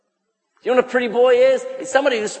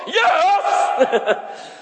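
mock eager yes; 9 semitones higher than usual. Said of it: "Don't go".

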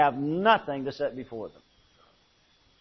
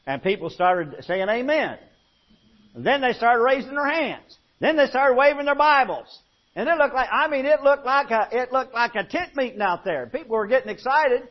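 louder and higher, building to a revival peak; preaching at full force; 8 semitones higher, 4 dB louder than usual. Real, not acted. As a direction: preach it up like a tent meeting. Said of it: "when I get stronger, I get louder".

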